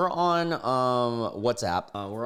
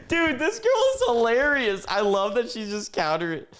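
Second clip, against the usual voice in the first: talking through high, squealing laughter; cracking up; 13 semitones higher, 4 dB louder than usual. Real, not acted. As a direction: barely get it out for laughing. Laughing at her comeback.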